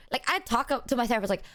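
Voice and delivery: normal talking voice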